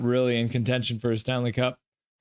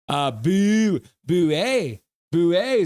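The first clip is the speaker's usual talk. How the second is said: booing; 4 semitones higher, 5 dB louder, slower than usual. Retold or imitated. Voicing a viewer's boos.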